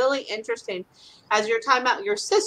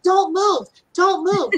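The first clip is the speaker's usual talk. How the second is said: higher and louder cat voice; voicing the cats; 8 semitones higher, 6 dB louder, slower than usual. Cats on Jeff telling him to stay put, higher and louder. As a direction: play the clingy cat, higher.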